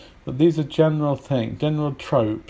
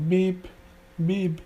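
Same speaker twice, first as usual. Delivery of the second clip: high-pitched vocal beeps; imitated time pips; 7 semitones higher than usual. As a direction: mimic a radio time signal. Imitating radio time signal.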